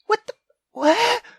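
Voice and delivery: ordinary delivery, nothing marked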